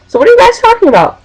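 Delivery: high, quick, chirpy question; acted-out question. Retelling herself asking the boys what's up, high chirpy question. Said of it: "walked over there and said".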